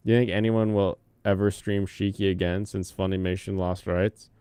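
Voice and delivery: ordinary delivery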